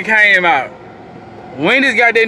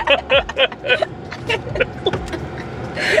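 soft wheezing laugh; breathy silent laugh; 7 semitones higher, 8 dB quieter than usual. Real, not acted. After joking about ribs, soft wheezing laugh. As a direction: laugh almost without voice. Laughing at own slapping joke.

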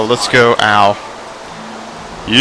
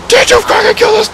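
very high incredulous shriek; outraged shriek; 3 dB louder than usual. Real, not acted. Gamer shrieking at Drowsy for killing the Snorlax. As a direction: screech it in disbelief.

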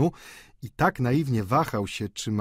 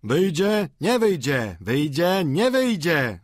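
raised singsong taunting chant; higher, rhythmic crowd cry; calling out alternately; 6 semitones higher, 4 dB louder, slower than usual. A narrator's reading. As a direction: chant it like two sides taking turns. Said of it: "wołano po kolei".

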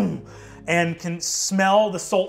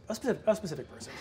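flustered rush; 4 semitones higher, 8 dB quieter, much faster than usual. Acted. Sandra Lynn flustered, hurrying off.

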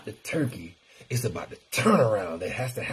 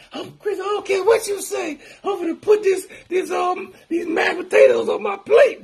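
high, loud mock-outraged shout; mock angry follower; 6 dB louder than usual. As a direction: play the defiant fan yelling back. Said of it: "y'all be mad at me like".

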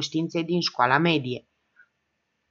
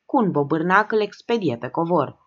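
plain reading voice

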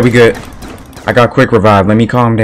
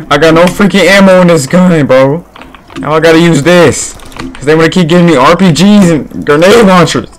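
raised, higher frustrated complaint; 7 semitones higher than usual. Real, not acted. Out of ammo, frustrated.

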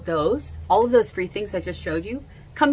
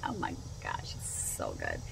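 hushed gush; 12 dB quieter than usual. Real, not acted. Savoring the spicy drink.

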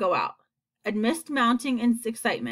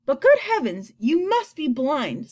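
emphatic incredulous outburst; exasperated exclamation; 6 semitones higher, 5 dB louder than usual. A narrator's reading. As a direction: exclaim it at someone missing the obvious. James exclaims at the earthworm.

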